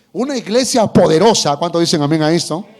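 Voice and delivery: fuerte y sonoro, arengando; predicación enérgica. Real, not acted. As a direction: levanta la voz a la congregación. (predicador exalta a la iglesia, mucho más fuerte)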